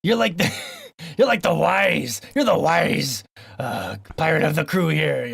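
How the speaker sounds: lightly voiced pirate teasing the crew; mock salty pirate, breaking into laughs. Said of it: "this stupid voice".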